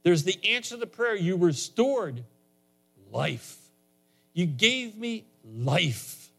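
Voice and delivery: high-pitched, impassioned pulpit delivery; fervent preaching. Real, not acted. Preacher tells congregation how you get saved, fervent and high-pitched. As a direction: preach it with rising urgency, pitched well up. Preaches salvation and models prayer.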